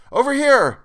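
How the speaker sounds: high, loud shout over roaring noise. A narrator's reading. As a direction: call out so they can find you. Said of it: "I shouted above the crashing and roaring".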